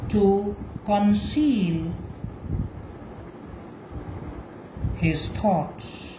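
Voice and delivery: slow, low, deliberate recitation with long pauses; measured classroom quotation; slow dictation. Shown as a demonstration. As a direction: dictate the epigram word by word. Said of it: "let us take an example of epigram".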